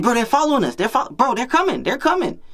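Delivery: very high, breathless hype; frantic excitement. Retold or imitated. Friend panicking with excitement.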